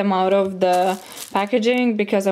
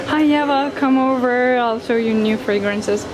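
higher, louder opening, imitating a welcome; sweet friendly greeting; 3 semitones higher, 4 dB louder than usual. Perfume shop friend inviting her over, imitated sweet greeting. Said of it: "He's so sweet. He always says".

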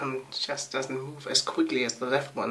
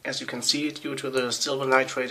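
ordinary talk, nothing marked

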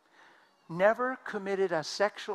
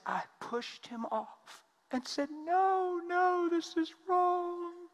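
high, slow, tearful recollection; crying woman; 14 semitones higher, much slower than usual. Retold or imitated. Woman telling the preacher her shame, high and slow. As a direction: draw out the no's, voice up high. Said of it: "She's crying".

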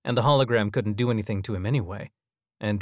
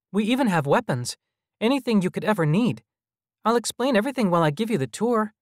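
put-on high, eager little-boy voice; persuading child; 12 semitones higher, 4 dB louder, faster than usual. A narrator's reading. Child hologram offering food and rest, high and coaxing. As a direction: coax them in a high child's voice.

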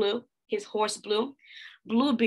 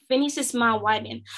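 plain reading voice

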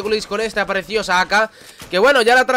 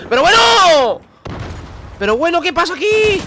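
amazed exclamation; 9 semitones higher than usual. Real, not acted. Surprised at multiple kills.

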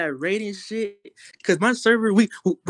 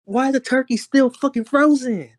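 high-pitched indignant mom; hammed-up mom complaint; mock scolding mom; 5 semitones higher than usual. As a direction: demand it like an annoyed mother, pitched up. Acting out his yelling mother.

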